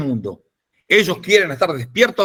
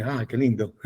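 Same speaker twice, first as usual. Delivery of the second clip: mother cooing; 5 semitones lower, 9 dB quieter than usual. Retold or imitated. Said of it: "Y dice".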